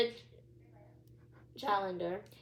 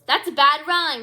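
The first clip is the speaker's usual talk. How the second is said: very loud, high outraged shout; queen shouting at the crocodile's rhyme; 5 semitones higher, 13 dB louder than usual. Acted. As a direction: blast it out in exasperation. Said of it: "she shouted".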